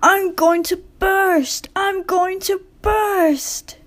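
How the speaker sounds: high, slow, strained panic; frightened engine crying out; panicked. A narrator's reading. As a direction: wail it as if about to burst.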